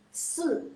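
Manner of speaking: Se with a falling fourth tone; fourth-tone demo. Shown as a demonstration. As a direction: drop Se sharply from high. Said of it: "Kayak seperti ini".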